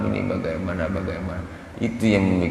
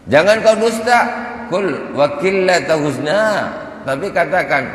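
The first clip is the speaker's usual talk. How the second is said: much higher, louder scolding; stern rebuke; 10 semitones higher, 4 dB louder than usual. Retold or imitated. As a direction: scold him like a strict teacher catching a lie. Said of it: "kata Sayyidah Rabi'ah".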